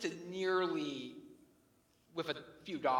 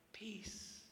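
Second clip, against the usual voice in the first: whispered word; 4 semitones higher, 10 dB quieter than usual. Acted. Sermon climax on inner peace.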